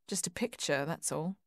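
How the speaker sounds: quiet, wistful echo of his words; soft echo. A narrator's reading. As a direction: murmur it to herself, remembering. She echoes his words softly.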